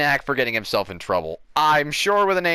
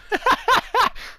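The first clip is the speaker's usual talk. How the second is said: shrill mad cackle, way up high; hammed-up crazy laugh; manic high laugh; 3 dB louder than usual. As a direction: cackle like a man losing it. [name] laughing madly.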